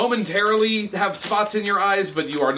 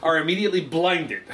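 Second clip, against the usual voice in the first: ordinary talk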